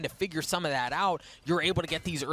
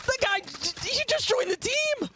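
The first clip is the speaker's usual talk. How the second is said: screaming hype, much higher, louder; esports caster; 14 semitones higher, 5 dB louder, slower than usual. Real, not acted. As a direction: lose it over the play. Caster hyped on Navera play.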